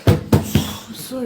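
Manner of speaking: very slow whispered apology; whispered plea. Acted. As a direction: breathe the apology out slowly, no voice. Caught wife pleads with husband.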